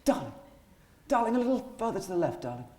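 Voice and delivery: a hint of a gushing stage director calling out; lightly voiced director coaxing from the dark; theatrical director. Director Joan Knight calling to the extras from the dark, lightly gushing. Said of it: "Joan Knight was there".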